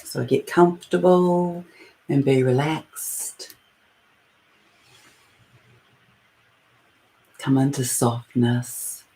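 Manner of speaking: guided meditation. Acted. Leading listeners into their breath and heart space, guided meditation. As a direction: ease listeners inward, phrase by unhurried phrase.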